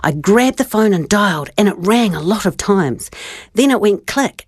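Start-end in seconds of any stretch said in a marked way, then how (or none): none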